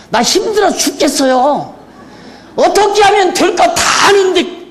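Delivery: shouting in exasperation; exasperated shout. Real, not acted. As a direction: cry out how hard it is. Preacher venting own frustration.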